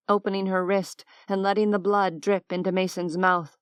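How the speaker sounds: plain reading voice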